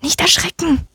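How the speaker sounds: high, tiny character voice; little mouse voice. A narrator's reading. Hidden mouse telling the donkey not to be startled, high tiny voice. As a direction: pipe up as a small unseen creature. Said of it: "eine leise Stimme".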